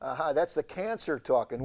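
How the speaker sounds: normal talking voice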